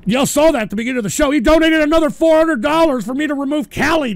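fast, loud, high complaining; exasperated rant. Real, not acted. Streamer complaining about another removal donation. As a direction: rush it out in disbelief.

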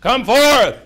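loud, high commanding call; King James proclamation. Acted. Jesus calling the dead man out of the cave, grandly commanding. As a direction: boom it out like scripture. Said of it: "He did King James too".

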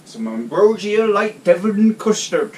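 put-on slow, high, loud West Country accent. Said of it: "It's my best West Country accent there".

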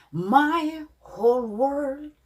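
"world" with a full r and l, not "월드"; pronunciation of world. Shown as a demonstration. Teacher models how to say world to learners. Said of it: "월드가 아니라 world".

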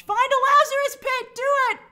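high, loud, egging the show on; excited shout. Real, not acted. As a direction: cheer him on at the top of your voice. Urging a character in excitement.

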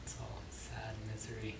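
whisper. Pretend. Deadpan joke answer.

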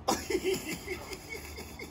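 a soft high laugh; a real soft laugh; high amused laugh. Real, not acted. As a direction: laugh softly, high, at the dog. Owner laughs at dog.

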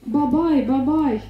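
mock frightened cry. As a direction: cry out in mild pretend horror. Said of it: "и вдруг закричала".

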